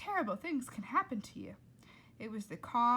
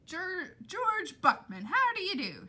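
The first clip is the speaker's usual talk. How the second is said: higher, louder hearty greeting; shouted greeting; 10 semitones higher, 8 dB louder than usual. Acted. Father shouting a jolly greeting at the cook. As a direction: shout it cheerfully over the chaos. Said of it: "shouted Flora's father".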